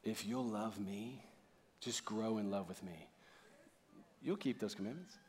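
very soft, low, gentle voice; tender invitation. Acted. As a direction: speak lovingly, almost under your breath. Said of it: "What if Jesus said it like this?".

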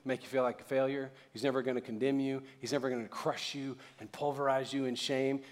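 ordinary talk

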